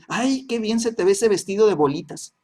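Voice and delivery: friend's compliment. Acted. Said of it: "le dice su amiguita".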